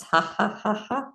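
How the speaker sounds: light, deliberate ha-ha laugh; laughing sounds. Shown as a demonstration. Teacher leading crossing-over exercise, practised light laughter. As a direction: laugh in neat repeated beats. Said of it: "just making some laughing sounds".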